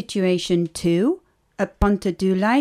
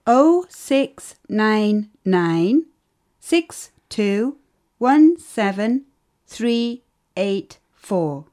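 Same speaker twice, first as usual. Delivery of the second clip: slow, careful digit by digit; slow and clear; much slower than usual. Acted. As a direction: spell out each digit slowly for the listener. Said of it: "Not so fast. Can you say it again".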